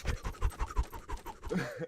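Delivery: voiceless rapid puffing noises; quick breathy bursts, like flurrying hand strikes; imitated rapid-fire noise. Sumo fighter grabbing food at the table, voiceless puffing bursts. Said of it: "you're just like".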